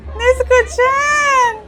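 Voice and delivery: high, slow, drawn-out squeal of the name; mock anime call-out. Reacting to a cosplayer, she squeals the name high and slow. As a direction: call the name like a fangirl crying out.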